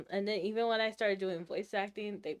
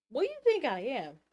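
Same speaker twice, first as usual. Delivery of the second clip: retold indignant retort; 5 semitones higher, 4 dB louder than usual. Acted retort to viewers.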